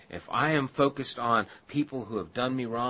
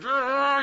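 strangled frustrated cry; 7 dB louder than usual. Retold or imitated.

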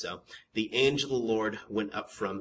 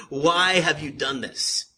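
slow, higher, drawn-out demand; emphatic questioning; 4 semitones higher, 4 dB louder than usual. Acted. God confronts his people in the verse, emphatic question. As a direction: stretch out WHY and press the question. God's question repeated in two ways.

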